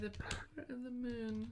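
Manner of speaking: put-on high breathy magical girl call. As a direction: chant it like a transforming heroine.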